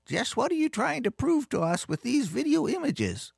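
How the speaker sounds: put-on very high-pitched batty old lady voice; crazy old cat lady. A narrator's reading. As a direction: demand it in a screechy-high old lady falsetto. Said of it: "the crazy old cat lady asked".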